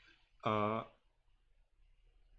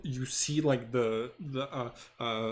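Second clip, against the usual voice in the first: ordinary talk, nothing marked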